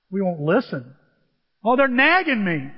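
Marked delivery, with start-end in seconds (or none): 1.6-2.7 mock complaint. Acted complaint of nagged listener.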